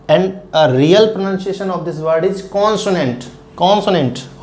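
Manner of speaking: slow, deliberate, every syllable of consonant clear; slow careful model. Shown as a demonstration. Teacher to students, slow model reading. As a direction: read it out slowly like a tutor. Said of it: "The proper".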